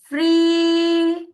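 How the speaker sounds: usual speaking voice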